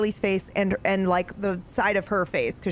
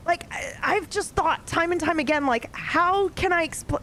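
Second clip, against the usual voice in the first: ordinary talk, nothing marked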